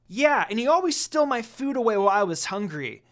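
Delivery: put-on high, loud child voice snapping; a kid fox tattling angrily; snapped. A narrator's reading. Shippo snapping at Inuyasha in a high child voice. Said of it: "Shippo snapped".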